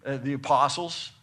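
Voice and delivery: ordinary talk, nothing marked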